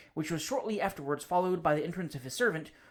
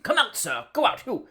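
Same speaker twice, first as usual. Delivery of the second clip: a panicked, louder cry at the dog; frightened cry; 7 semitones higher, 7 dB louder than usual. Acted. Bachelor fearfully shouts the dog away from his curtains. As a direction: shoo the dog from a safe distance. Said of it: "cried poor Augustus".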